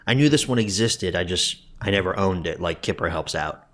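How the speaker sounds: ordinary delivery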